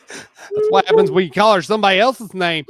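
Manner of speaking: loud, fast teasing aside; excited. Real, not acted. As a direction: blurt it out laughing.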